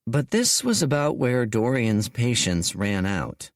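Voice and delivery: normal talking voice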